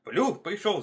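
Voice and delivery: high, loud alarm cry; shouting hares. A narrator's reading. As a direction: cry the warning out. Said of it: "закричали остальные зайцы".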